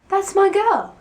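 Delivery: high, loud possessive huff; angry huff. Acted. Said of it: "Louis huffed angrily".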